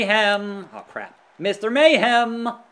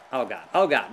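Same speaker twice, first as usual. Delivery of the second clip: ordinary talk, nothing marked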